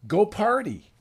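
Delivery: high and loud, cheering him on to party; an upbeat invitation to go have fun; excited God voice. Retold or imitated. God's command, voiced enthusiastically.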